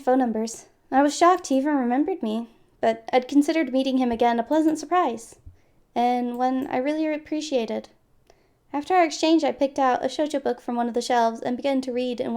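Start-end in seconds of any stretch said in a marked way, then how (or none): none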